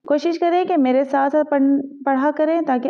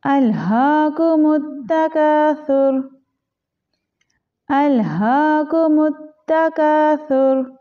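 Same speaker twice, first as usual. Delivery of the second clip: slow, chanted tajweed recitation; Quran recitation; 3 semitones higher, much slower than usual. Shown as a demonstration. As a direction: recite it like a teacher leading students.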